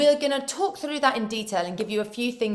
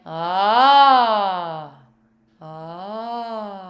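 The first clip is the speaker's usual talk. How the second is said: low chest ah; 5 semitones lower, 4 dB louder than usual. Shown as a demonstration. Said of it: "and just say".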